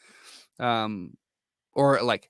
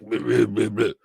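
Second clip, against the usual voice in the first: put-on Spanish accent gibberish; 3 dB louder than usual. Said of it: "Spanish accent".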